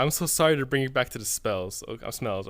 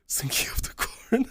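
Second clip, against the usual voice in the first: laughing whisper. Real, not acted. Laughing at memory of corn.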